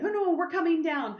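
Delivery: high-pitched mom brushing off objections; insistent mother. Retold or imitated. Mom overrules daughter about visiting, insistent.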